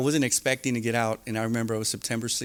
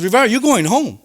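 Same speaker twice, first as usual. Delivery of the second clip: much higher and louder news; mock announcement; 11 semitones higher, 11 dB louder than usual. Counselor telling him he is going home, loud and high. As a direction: announce it big and bright. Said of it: "He goes".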